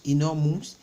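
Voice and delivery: ordinary delivery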